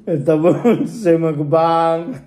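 ordinary delivery

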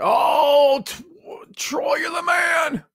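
high, drawn-out cheer; excited praise. Real, not acted. Host hails Troy for his tip, excited high cheer. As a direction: stretch it out high, hailing a hero.

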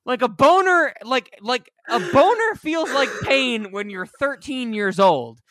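incredulous rant at the screen; exasperated retort. Retold or imitated. Retelling his reaction to the movie, exasperated and loud. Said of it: "And I'm like".

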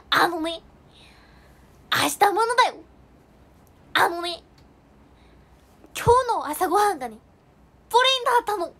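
put-on high, loud child-star voice; 芦田愛菜. Child actress impression telling viewers about breakfast, high and loud. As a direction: chirp it up like a little child actress. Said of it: "ちゃんのモノマネ".

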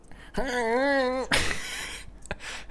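a high, strangled braying sob; donkey-like sob. Retold or imitated. Mimicking the crying stranger in the next stall, high braying sob. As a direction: imitate a man's stifled crying that comes out as a high bray. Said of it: "sounding like a fucking donkey".

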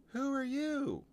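put-on very high little-girl story voice; high, questioning child character; Lucy. Small visitor questioning a stranger, very high-pitched. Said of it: "said Lucy".

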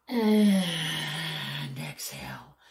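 long breathy exhale; slow exhale. Shown as a demonstration. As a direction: breathe out slowly on the word. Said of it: "and then exhale".

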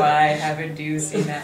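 giggly mock cyborg brother; cyborg impression. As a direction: play the brother as a laughing cyborg. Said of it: "the best impression a cyborg can do of her brother".

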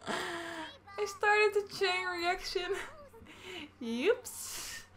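high, slow words through giggles; laughing at her own mischief. Real, not acted. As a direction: giggle through it, tickled by the mess.

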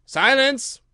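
high, loud command; ringing royal call for silence. A narrator's reading. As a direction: shout for order in court. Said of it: "called out".

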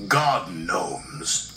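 low, slow, knowing pronouncement; deliberate low-voiced authority figure; boss explaining. A narrator's reading. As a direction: state it slowly and firmly, falling.

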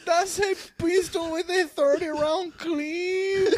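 slow, high mock sobbing; crying. Suspect describing gun to officer, mock crying. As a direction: whine every word out in fake tears. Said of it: "He just started crying".

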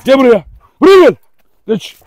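loud, high shouted orders; shouted commands. Real, not acted. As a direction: shout orders at an unruly dog. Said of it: "Şimdi niye bağırıyorsun derseniz". (bağırarak emir verme)

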